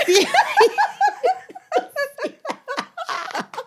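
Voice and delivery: long high-pitched laughter; high squealing laugh. Real, not acted. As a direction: shriek with laughter. Laughing at friend's accent.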